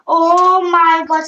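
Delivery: surprised shout. Real, not acted. Game glitch surprise.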